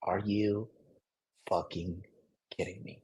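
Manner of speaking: low, soft, deadpan disbelief; hearing the girlfriend's panic, low soft disbelief; flat disbelief. Retold or imitated. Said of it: "And I was like".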